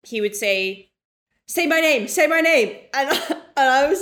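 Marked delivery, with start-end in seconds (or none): 1.5-2.8 louder, higher mock command; mock bedroom demand. Ex in bed demanding his name, loud and high. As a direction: ham up his urgent chant.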